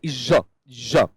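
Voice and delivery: "ज" sounded alone, then repeated; "ज" set apart with a pause after each; sound demonstration. Shown as a demonstration. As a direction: model the isolated consonant "ज" twice. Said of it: "इस तरीके का sound".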